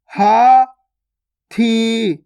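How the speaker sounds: हाथी split as haa, pause, thee; syllable by syllable. Shown as a demonstration. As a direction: slowly sound out हाथी in two held syllables. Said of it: "direct मात्रा का उच्चारण करके पढ़ेंगे". (हाथी को तोड़कर, हा... थी)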